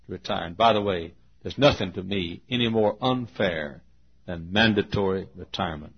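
ordinary talk, nothing marked

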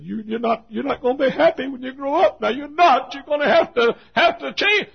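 high, loud retold rebuke; pleading shout. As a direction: shout a high-pitched warning at a wayward teen. Acted scolding of the girl.

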